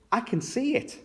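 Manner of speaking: high, eager cry; high-pitched storybook glee; excited cry. A narrator's reading. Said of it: "cried Pepper".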